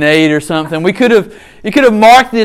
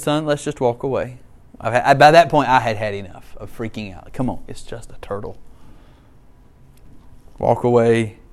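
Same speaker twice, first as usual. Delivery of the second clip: much softer, lower tired voice; weary quiet; 8 semitones lower, 6 dB quieter than usual. Retold or imitated. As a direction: done with it, softly.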